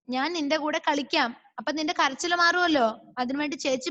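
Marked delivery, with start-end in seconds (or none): none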